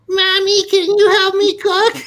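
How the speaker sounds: put-on high, childish pleading voice; hammed-up squeaky little-boy plea; grown man whining to mommy. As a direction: beg like a helpless little kid. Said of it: "a forty-two-year-old man, you're like".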